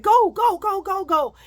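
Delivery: loud high urging go; telling the bus driver to go, loud; urgent go. Retold or imitated. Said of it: "I said".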